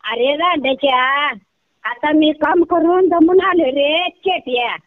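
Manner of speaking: ordinary talk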